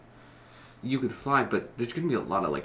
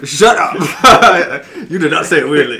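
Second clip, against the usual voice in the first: loud, higher, laughing retort; laughing protest; 6 semitones higher, 15 dB louder, slower than usual. Real, not acted. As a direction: shout it down through laughter. Laughing protest at being teased.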